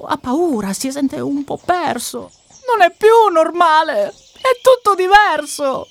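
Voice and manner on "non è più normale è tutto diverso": put-on high, loud child voice; excited sister. Sister tells brother everything is different, excited. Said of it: "fa la sorella".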